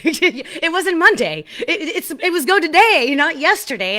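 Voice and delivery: high, loud, laughing at herself; yelping with amused disbelief. Real, not acted. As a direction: burst out at her own silly mistake. Laughing at own mistake.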